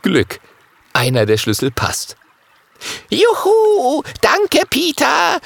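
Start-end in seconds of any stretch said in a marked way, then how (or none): 3.1-5.4 far higher, louder cheer, then slow thanks; joyful cheer. Acted. Little dragon freed from his chain, cheering joyfully. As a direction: whoop for joy, then draw out the thanks. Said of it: "ruft der kleine Drache".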